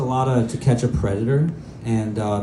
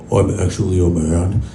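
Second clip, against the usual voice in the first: low reveal; 4 semitones lower than usual. Retold or imitated. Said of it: "she'd be like".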